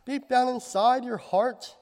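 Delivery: usual speaking voice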